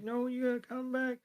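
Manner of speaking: high, soft plaintive voice; pleading little Cubone. Acted. Cubone to the departing Marowak, pleading.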